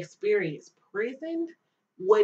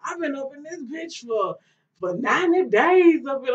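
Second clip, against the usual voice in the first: higher, rough imitation; imitating a seasoned cellmate, somewhat higher; tough inmate mocking; 7 semitones higher, 5 dB louder than usual. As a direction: mimic a hardened inmate.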